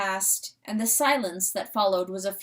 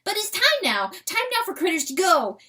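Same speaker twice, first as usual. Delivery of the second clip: high, loud, fast, anxious calling; fearful call; 9 semitones higher, 4 dB louder, faster than usual. Acted. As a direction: hurry them off, fearful. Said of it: "he called, truly fearful".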